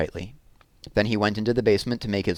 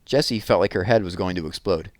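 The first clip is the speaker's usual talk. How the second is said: ordinary delivery, nothing marked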